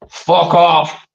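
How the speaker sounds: mock-furious Scottish accent. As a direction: ham up an angry Scottish outburst. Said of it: "best Scottish accent I can do".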